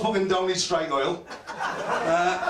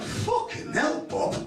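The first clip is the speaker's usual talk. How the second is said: a breathy, high "Fucking hell, Bob" in retold shock; mock shocked exclamation; 7 semitones higher, slower than usual. Teammate mocks Bob's towel in the changing room with a breathy high gasp. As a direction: gasp it out as if caught off guard in the showers.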